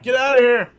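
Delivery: high, loud mock yell. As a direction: bark them out the door, hammed up. Mock boss yelling at Bobby.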